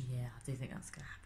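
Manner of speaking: fast unvoiced whisper. Real, not acted. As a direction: confide it under your breath. Aside after going cuckoo.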